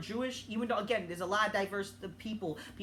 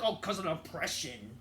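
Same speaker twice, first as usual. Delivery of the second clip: mocking quote; 9 semitones lower, 6 dB quieter than usual. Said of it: "And don't tell me again, it's like".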